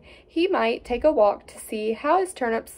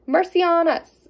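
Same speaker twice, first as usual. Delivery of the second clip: high, louder cry of alarm; exclaiming; 6 semitones higher, 3 dB louder than usual. A narrator's reading. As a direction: gasp it out as the shocked wife. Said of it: "exclaimed his wife".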